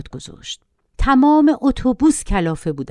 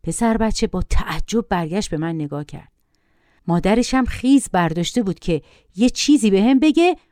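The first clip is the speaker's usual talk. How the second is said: plain reading voice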